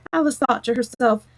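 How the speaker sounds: usual speaking voice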